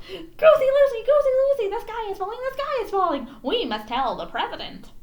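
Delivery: high, loud, frantic yell; panicked chicken yelling to a goose; yelling hen. A narrator's reading. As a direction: shout the alarm at the goose. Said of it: "and yelled".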